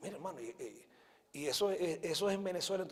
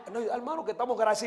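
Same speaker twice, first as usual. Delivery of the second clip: ordinary talk, nothing marked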